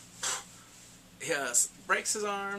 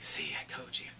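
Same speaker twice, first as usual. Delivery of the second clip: whispered taunt; 4 semitones lower, 3 dB louder than usual. Retold or imitated. Retelling villain's taunt.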